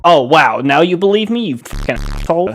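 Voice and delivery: high, loud mocking jab; sarcastic outburst. Kid gloating at parents after the eye test, high and loud. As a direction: rub it in their faces.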